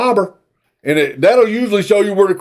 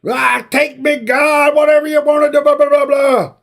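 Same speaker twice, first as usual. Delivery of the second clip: mock screaming at God; 5 semitones higher than usual. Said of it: "he started screaming at the Lord".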